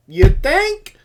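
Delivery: loud, high, sarcastic yelp; incredulous retort. Retold or imitated. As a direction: fire it back in loud mock disbelief. Retold sarcastic retort to painful treatment.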